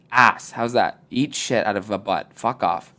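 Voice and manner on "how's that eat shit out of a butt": ordinary delivery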